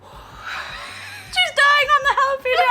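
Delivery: high, loud laughing shout. Real, not acted. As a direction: cackle it out. Laughing at partner's suffering.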